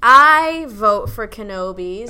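loud, slow declaration; emphatic declaration. Real, not acted. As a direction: announce it proudly.

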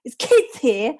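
loud, slow imitated Cockney protest; mock-indignant Cockney call-out; proper Cockney. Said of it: "he's, like, proper Cockney".

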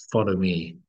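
ordinary delivery

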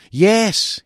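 high, loud, keen; bright eager reply; eager yes. A narrator's reading. Eager young colleague Sam answers.

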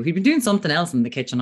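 ordinary delivery

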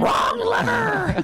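high, slow yelling outburst; shouted movie quote. Retold or imitated. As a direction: yell it out, stretched and high. Quoting Emperor's New Groove.

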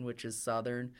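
normal talking voice

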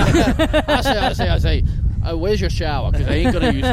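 loud, high cartoon rooster voice, laughing; Foghorn Leghorn impression. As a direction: bellow it like a blustery cartoon rooster. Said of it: "my foghorn leghorn".

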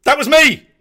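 sudden high delighted yell; loud, high burst of recognition; excited shout. Real, not acted. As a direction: shout it out, pointing at the screen. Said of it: "sees himself on screen".

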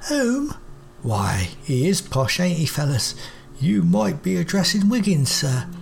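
high, cheeky cockney courier bot; mock the posh word, then shrug off the lost job; cockney robot Wiggins. Wiggins robot mocks and explains.

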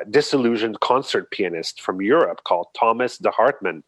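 usual speaking voice

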